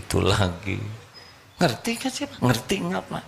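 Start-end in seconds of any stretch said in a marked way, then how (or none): 1.6-3.2 high, pressing wife voice; nagging wife. Retold or imitated. Wife pressing husband, high and nagging. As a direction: needle him like a wife.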